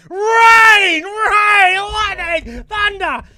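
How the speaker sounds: high, full-volume drawn-out yell; wildly overdone high-pitched shouting; shouted lightning call. Acted. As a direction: bellow it like a madman mid-joke. Climax of the shouted weather act.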